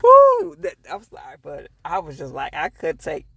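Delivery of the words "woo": a loud, high excited whoop; real excited whoop. Real, not acted. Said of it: "reaction whoop".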